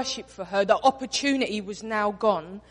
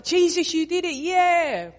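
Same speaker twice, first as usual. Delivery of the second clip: high, loud mock applause; a mock party cheer, higher and louder; 7 semitones higher, 5 dB louder than usual. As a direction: cheer him on like a crowd, hammed-up.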